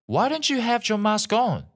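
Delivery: high, firm nurse questioning; stern nurse. A narrator's reading. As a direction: nurse scolds the maskless man, lightly voiced firm questioning. Nurse confronts maskless man.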